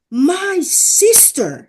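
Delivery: high, drawn-out calling out; imitated yell. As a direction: imitate someone hailing a sister, stretching the words. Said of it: "someone yelled".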